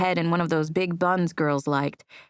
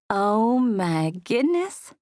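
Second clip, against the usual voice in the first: slow, lightly drawled Southern coo; hint of a smooth Southern coo; cooing Southern accent; 3 semitones higher, much slower than usual. A narrator's reading. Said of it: "she cooed in her smooth Southern accent".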